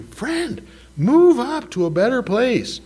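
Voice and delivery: high, bright-toned gracious host; a gracious host beckoning a guest; host's welcome. Acted. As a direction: call the guest up warmly, high.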